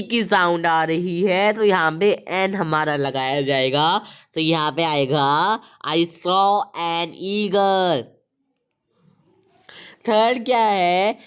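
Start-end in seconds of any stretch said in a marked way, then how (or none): 5.9-8.0 very slow, word-by-word sentence reading; slow dictation. Shown as a demonstration. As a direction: read it out slowly for students to write. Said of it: "teacher reads out the answer". (धीरे-धीरे बोलकर लिखवाना)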